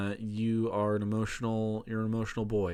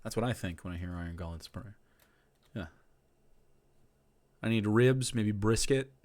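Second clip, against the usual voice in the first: usual speaking voice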